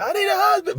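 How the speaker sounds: high mock plea for a husband; imitating her high cry; desperate woman. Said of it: "She's like".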